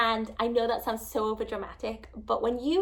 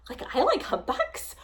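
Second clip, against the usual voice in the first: put-on like a little child; 4 semitones higher than usual. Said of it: "like a little child".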